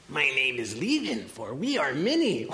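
eerie, swooping demonic voice; unhuman demon voice. Acted. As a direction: let the pitch slide up and down like something inhuman. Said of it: "more unhuman than human alike".

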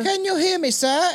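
put-on high, polite British child; put-on little-boy British accent. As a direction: imitate a small British boy. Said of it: "A little British boy".

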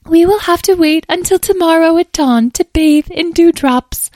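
very high, loud fairy voice; dainty fairy. A narrator's reading. Muddy fairy plans a dew bath, fussy and high-pitched. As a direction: play a fussy little fairy, pitched right up.

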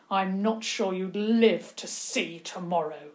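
loud, emphatic scolding; angry tirade. A narrator's reading. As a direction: hit the key words hard in outrage. Uncle berates niece over escaped pigs.

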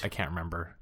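very soft, low admission of forgetting; sheepish admission. Real, not acted. Host admitting the stage name is gone, sheepish admission. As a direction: own up quietly, voice dropped right down.

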